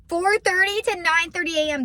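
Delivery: high, loud thrilled echo of the hours; delighted. Real, not acted. A morning person thrilled by the early shift, loud and high. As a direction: repeat the hours like a gift.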